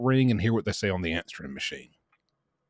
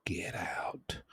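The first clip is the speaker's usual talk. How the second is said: frightened breathy whisper; scared whisper; 12 dB quieter than usual. Retold or imitated. Little Paul whispering at the robot sister in fear.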